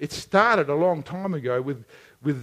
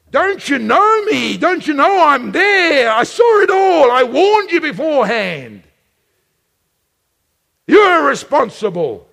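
God's thundering rebuke; 8 semitones higher, 7 dB louder than usual. Retold or imitated. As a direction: thunder at the guilty son. God rebukes Cain.

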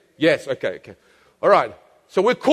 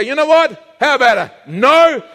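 louder, higher challenge; defiant no; 4 semitones higher, 5 dB louder than usual. Acted. The believer turning down the tempter with a loud, high no. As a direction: fling the no back at the tempter. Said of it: "You say".

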